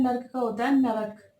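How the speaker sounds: normal talking voice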